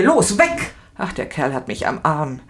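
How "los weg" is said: higher, louder cry to get away; shout. A narrator's reading. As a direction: yell at him to let go. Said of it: "Ich schreie". (Verhafteter schreit den Beamten an, er solle loslassen)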